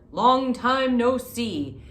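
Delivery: loud cheerful hare; hearty hare greeting. Acted. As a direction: greet an old friend loudly.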